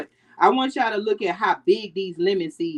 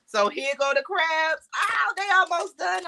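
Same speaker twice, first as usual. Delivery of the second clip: upbeat, higher, eager; excited; 8 semitones higher than usual. Real, not acted. Showing viewers the crabs nearly done, excited. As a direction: announce the crabs with glee.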